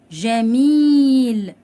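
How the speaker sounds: جميل very slow and loud, "ja-meeel"; جميل stretched out for the class; drawn-out long vowel. Shown as a demonstration. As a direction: hold the "ee" in جميل. Teacher stretches example word's long vowel.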